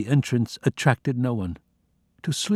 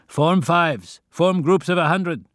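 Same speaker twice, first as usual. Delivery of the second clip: high, loud commands through a loudspeaker, last line slow; loudspeaker orders; 7 semitones higher, 3 dB louder, slower than usual. Acted. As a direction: bark camp orders over a tinny PA. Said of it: "Orders were given us through loudspeakers".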